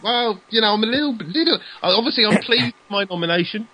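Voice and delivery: a hint of a grand thank-you speech; mock acceptance speech. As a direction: play the gracious nominee, tongue in cheek.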